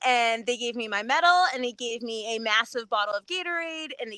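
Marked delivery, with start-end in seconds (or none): none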